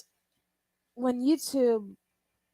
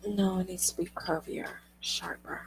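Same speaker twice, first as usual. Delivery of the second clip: breathy unvoiced whisper; 10 dB quieter than usual. Real, not acted. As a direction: whisper it close to the mic. ASMR style whisper.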